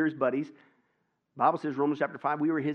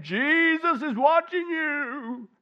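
slow, high, louder, eerie call from the dark; disembodied voice; 12 semitones higher, 6 dB louder, much slower than usual. A narrator's reading. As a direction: stretch each word like an unseen voice. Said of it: "strange disembodied voice".

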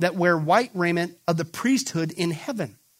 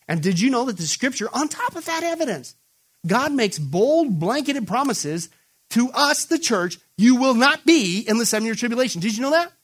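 full-force preaching; 7 semitones higher, 6 dB louder than usual. Acted. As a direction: thunder the promise to the church. Preaches the church's promise.

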